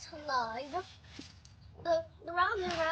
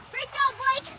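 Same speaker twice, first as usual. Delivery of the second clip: calling from far away; 7 semitones higher, 9 dB louder than usual. Real, not acted. Kid calling praise across pool.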